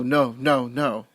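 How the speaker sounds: ordinary delivery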